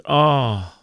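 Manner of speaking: ordinary talk, nothing marked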